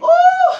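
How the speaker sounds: high, loud whoop of excitement; joyful woo. Real, not acted. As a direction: let out a big preacher's whoop. Preacher's exclamation in full flow.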